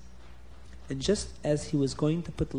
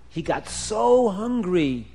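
usual speaking voice

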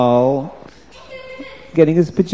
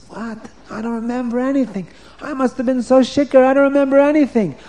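bewildered king; 8 semitones higher, faster than usual. Acted. King cannot remember.

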